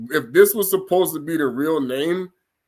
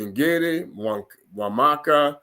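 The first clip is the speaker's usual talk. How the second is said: ordinary talk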